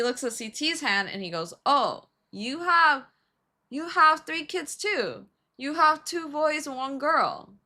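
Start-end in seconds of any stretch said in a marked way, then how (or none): 1.6-7.5 high, slow mock delight; drawn-out, hammed-up enthusiasm; impressed fortune teller. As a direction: imitate a delighted palm reader, pitched up. Palm reader delighted with C T's fortune.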